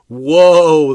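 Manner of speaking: one loud, slammed Woe; booming woe. Shown as a demonstration. Preacher showing how harsh Woe sounds, booming it. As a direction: bang the word Woe out like a boom. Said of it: "The whole thing sounds like boom".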